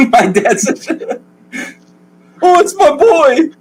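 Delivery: high, loud blubbering father; weepy overjoyed dad. Acted. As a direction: sob with joy at your son's return. GM voices overjoyed crying dad.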